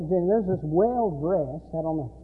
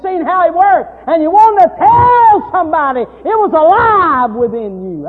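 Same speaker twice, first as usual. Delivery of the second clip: loud, high preaching cadence; preaching at full force; 9 semitones higher, 13 dB louder than usual. Acted. Preacher retelling the story to his congregation, loud and fervent.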